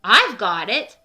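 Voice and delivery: sudden loud eureka; bright idea. A narrator's reading. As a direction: announce the idea with excitement. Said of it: "Ollie said".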